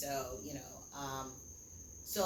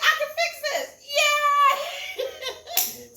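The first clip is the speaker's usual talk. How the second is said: very high, loud, laughing cheer; giddy high yay through laughter and tears; elated cheer; 11 dB louder, much slower than usual. Retold or imitated. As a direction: squeal it out, laughing and crying at once. Joyful realisation, laughing-crying.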